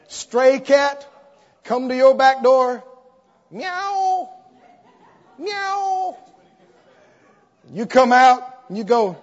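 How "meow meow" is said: high, plaintive mock meows; cat meowing. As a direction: mew like a begging stray cat. Stray cat at the back door.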